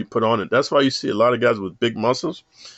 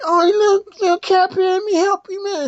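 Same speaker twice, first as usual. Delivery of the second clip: put-on high, sugary little-girl voice, much higher; a little girl cooing to a caterpillar; 4 dB louder than usual. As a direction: play a sweet naive little girl. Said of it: "the little girl's like".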